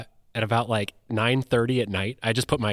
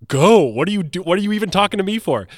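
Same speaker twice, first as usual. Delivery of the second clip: loud, higher imitation of an impatient wife; mock exasperation; 7 semitones higher, 7 dB louder than usual. Wife shooing husband off to the theater, exasperated. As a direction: burst out with GO, then rush the question. Voices wife's impatient reply.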